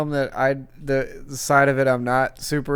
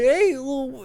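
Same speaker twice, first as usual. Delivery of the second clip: Goofy voice; 12 semitones higher than usual. Retold or imitated. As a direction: ham up a silly cartoon dog. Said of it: "He's like".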